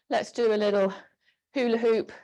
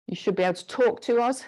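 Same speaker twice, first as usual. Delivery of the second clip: normal talking voice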